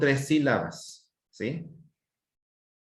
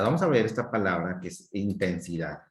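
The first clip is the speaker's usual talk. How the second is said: normal talking voice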